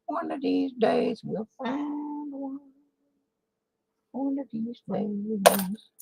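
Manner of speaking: high, slow, stretched out; high singsong. Pretend. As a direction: croon it slowly up high. Continues put-on singsong voice.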